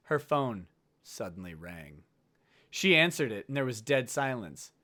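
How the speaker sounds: low, measured audiobook read; deliberate, solemn narrator; story narration. A narrator's reading. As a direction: read it out gravely and evenly. Reads book aloud.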